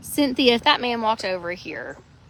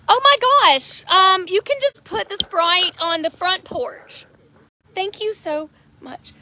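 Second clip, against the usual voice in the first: higher, bright thanks to a stranger; polite customer voice; 7 semitones higher, 5 dB louder than usual. Real, not acted. To the delivery man at the porch, higher polite voice. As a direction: sound cheerfully polite to the delivery guy.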